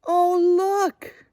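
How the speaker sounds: high, loud exclamation; delighted, drawn-out "look"; excited discovery. Acted. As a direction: draw the children's eyes with a big call. Excited discovery while reading to children.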